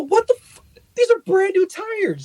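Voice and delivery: retold to a friend, high-pitched outraged disbelief; exasperated outburst. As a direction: squeal in outrage at brand new tires failing. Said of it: "I go".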